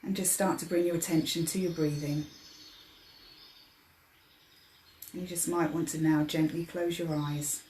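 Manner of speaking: soft, unhurried meditation voice with long pauses; guided meditation. Acted. Meditation leader easing listeners into their breathing, guided meditation. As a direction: guide them gently into relaxation, softly.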